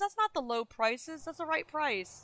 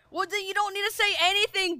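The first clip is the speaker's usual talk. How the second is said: higher, fast irritated retort; fast annoyed retort; irritated snap; 7 semitones higher, faster than usual. Real, not acted. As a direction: fire it back at chat, annoyed. Snapping at chat helper.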